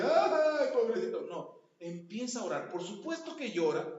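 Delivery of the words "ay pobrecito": high, loud whiny pity; mock self-pity. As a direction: wail it like a sorry-for-yourself patient. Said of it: "así de". (lamento burlón y agudo)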